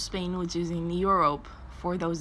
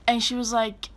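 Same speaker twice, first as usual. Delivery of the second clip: ordinary delivery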